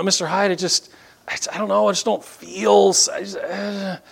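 acted hesitant confession; troubled student. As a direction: stammer it out, unable to finish. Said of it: "a lot of my students who will come to me privately".

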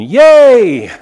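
put-on long, loud old-fashioned hooray; rejoicing cheer. Preacher acting out the Ammonites' glee in modern English, rejoicing cheer. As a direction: let out a drawn-out celebratory whoop. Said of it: "They're rejoicing".

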